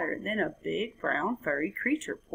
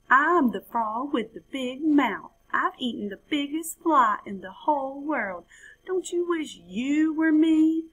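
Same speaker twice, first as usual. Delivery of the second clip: high, louder boastful little frog; bragging frog; 7 semitones higher, 4 dB louder than usual. Acted. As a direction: show off like a big-mouthed young frog. Frog brags to siblings.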